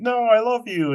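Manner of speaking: high-pitched retold love declaration; acted pleading. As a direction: imitate a sweetheart protesting. Girlfriend protests being sent away.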